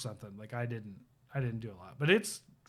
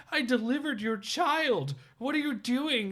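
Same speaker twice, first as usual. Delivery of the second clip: much higher, desperate pleading; pleading priest; 11 semitones higher than usual. Retold or imitated. Bleeding priest begging the woman attacking him, pitched high. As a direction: beg for your life, voice way up. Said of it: "pleading to her for his life".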